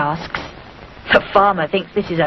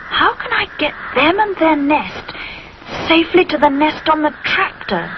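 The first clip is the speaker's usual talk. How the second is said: thinking aloud; 7 semitones higher than usual. A narrator's reading. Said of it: "thinks Rupert".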